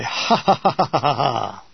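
staccato ha-ha laugh falling in pitch; descending run of mock laughter. A narrator's reading. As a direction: laugh at a bad pun, step by step downward. Laughing at his own bad pun.

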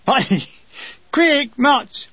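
mock sergeant ordering quick march; laughing drill command. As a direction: call the march through a laugh.

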